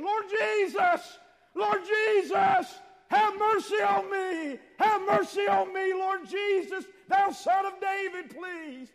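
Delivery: high, shouted plea for mercy; desperate begging. Acted. Mother begging Jesus for her daughter, desperate shouting. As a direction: cry out loud and high, stretching the words. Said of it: "this woman was begging".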